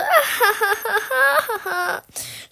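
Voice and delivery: high, loud jeering cackle; mocking laugh. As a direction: laugh at them scornfully. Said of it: "schrie Lilly".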